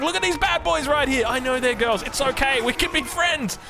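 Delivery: high-pitched, playful borrowed intonation; channeled comedian chef. Acted. Caster defending bad boys comment, channeled comedian chef. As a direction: lightly slip into an internet chef's cadence. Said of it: "I feel like I just channeled him".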